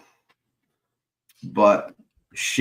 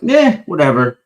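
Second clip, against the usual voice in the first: dismissive brush-off; 6 semitones higher, 8 dB louder than usual. Retold or imitated. Brushing off the injury.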